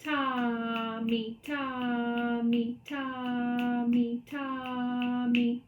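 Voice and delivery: long TA, short MI, chanted slowly; sustained TA with MI tucked on the end; slow rhythm demo. Shown as a demonstration. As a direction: chant the dotted pattern slowly and evenly. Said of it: "This is how I say that pattern".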